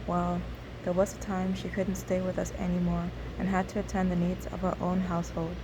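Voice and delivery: ordinary delivery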